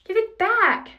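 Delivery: high, loud demand; loud, high-pitched outrage; indignant cry. A narrator's reading. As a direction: cry out for it to be returned. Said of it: "Percy cried".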